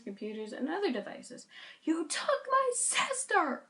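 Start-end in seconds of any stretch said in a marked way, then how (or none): 1.8-3.6 high, slow, strained cry; anguished yell. Acted. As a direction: cry out in grief and rage. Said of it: "I cried louder than before".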